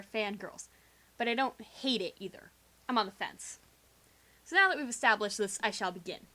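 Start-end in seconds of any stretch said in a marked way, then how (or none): none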